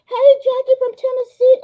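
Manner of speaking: high, loud squealed greeting; very high-pitched, excited hail; excited shout-out. Real, not acted. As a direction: squeal hello to family. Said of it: "I'm excited".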